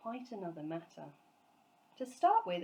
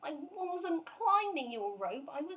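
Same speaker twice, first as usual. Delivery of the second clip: a lightly voiced, higher, slow flustered little bear; 9 semitones higher than usual. A narrator's reading. Bear explaining himself to the angry neighbour, flustered. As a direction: plead innocence, a little breathless. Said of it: "gasped Paddington".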